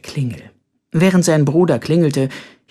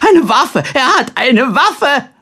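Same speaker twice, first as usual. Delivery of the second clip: high, loud panicked shriek; alarmed scream; 12 semitones higher, 6 dB louder, slower than usual. A narrator's reading. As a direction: cry out in terror of the weapon. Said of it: "stieß einen Schrei aus".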